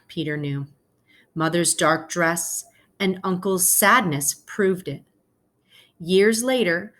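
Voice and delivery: gentle read-aloud to the class; story narration. A narrator's reading. Teacher reading a picture book to her class, storytelling voice.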